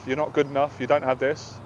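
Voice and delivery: low, soft self-criticism; negative inner voice. Retold or imitated. The voice in his head putting him down, low and quiet.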